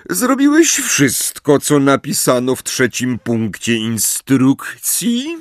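cold menacing professor, drawn out; slow icy interrogation; stern teacher voice. Acted. Snape interrogating Harry coldly.

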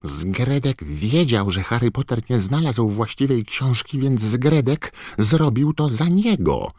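high, squeaky elf voice; Dobby house-elf voice. A narrator's reading. Dobby speaking of himself. (wysoki, piskliwy głos skrzata)

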